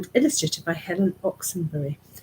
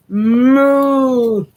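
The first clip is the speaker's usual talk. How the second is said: loud moo; 4 semitones higher, 11 dB louder than usual. Acted. Cow chasing farmer.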